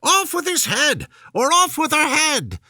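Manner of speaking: high, loud furious shout. Acted. Queen raging during croquet, high loud furious shout. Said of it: "stamping about and shouting".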